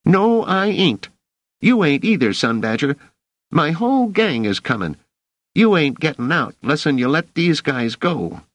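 put-on high, squeaky boy's defiance; high-pitched, emphatic refusal; defiant street kid. A narrator's reading. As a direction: threaten the officer as a scrappy kid. Boy defies officer with threat.

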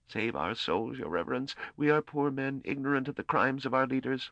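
high, frightened whimper; whimpering plea. A narrator's reading. Terrified soldier begging the priest to save souls, whimpering. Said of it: "one whimpered".

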